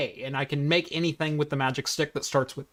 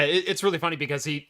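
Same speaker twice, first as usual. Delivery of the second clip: ordinary delivery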